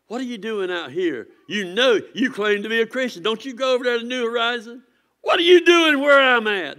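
taunting, building to a high loud sneer; mocking taunt. Scoffers taunting a churchgoer outside the church, mocking and rising to a shout. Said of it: "They're mocking you".

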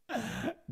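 high shrill cackling laugh; scary laugh. Pretend. Laughing at the adoption joke with a spooky cackle. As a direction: let out a spooky witch cackle. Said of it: "scary laugh".

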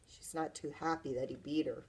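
ordinary delivery

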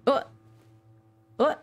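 ordinary delivery

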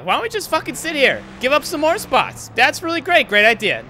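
angry sarcasm. Real, not acted. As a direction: sneer it at the crew. Sarcastic jab at slow crew.

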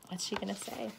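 usual speaking voice

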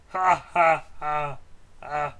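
slow, loud evil cackle; evil villain laugh. Pretend. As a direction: laugh like a gloating overlord.

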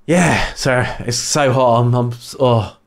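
breathless, panting through the words; out of breath from the heat. Real, not acted. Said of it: "God, I'm running out of breath".

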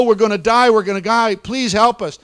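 high, loud panicked cry; high, loud terrified children's wail; screaming in terror. A narrator's reading. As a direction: scream it like you're burning alive. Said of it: "they were screaming and crying".

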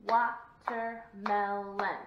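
WA-TER-MEL-ON in slow, even beats; slow, separated chant of Watermelon; clapped out. Shown as a demonstration. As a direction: clap out Watermelon one syllable at a time. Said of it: "We're gonna clap it out".

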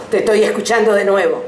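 ordinary delivery

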